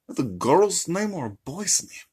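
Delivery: high cartoon villain accent; suspicious dad question in a comic foreign voice; put-on accent, rising and prying; Gru. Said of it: "And Gru".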